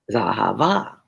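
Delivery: Zahava starting low and swooping up to a long VA; stress on last syllable. Shown as a demonstration. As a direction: exaggerate the final syllable of Zahava, rising. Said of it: "so many places I hit the accent".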